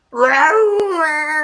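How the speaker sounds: high, loud cat wail; cat yowl. Acted. Stuck cat yowls again, high and loud. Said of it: "said Hercules Morse".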